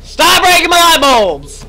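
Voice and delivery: loud, slow shout at the monster; angry yell. Real, not acted. Gamer yelling at Sophie the monster, angry shout. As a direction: yell it out, fed up.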